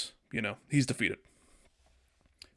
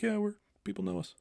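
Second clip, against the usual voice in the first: smug heroes; 9 semitones higher, 8 dB quieter than usual. Retold or imitated. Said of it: "them being like".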